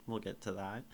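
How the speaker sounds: ordinary talk, nothing marked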